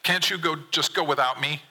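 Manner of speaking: high-pitched upset plea; rising, upset voice. A narrator's reading. Son begs dad to leave him behind, upset. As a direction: plead with the voice lifted high. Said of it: "Your voice rises as you start getting upset".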